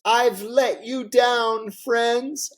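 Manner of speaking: high, slow, choked-up mock weeping; a hammed-up tearful apology; Clinton fake crying. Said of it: "And he starts to cry".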